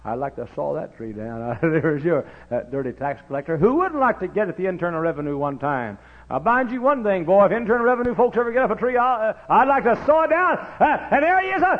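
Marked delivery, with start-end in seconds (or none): 3.6-11.8 high, loud hollered sermon lines; preaching at full force. Acted. Preacher hollering to the church about Matthew. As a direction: preach it out to the congregation.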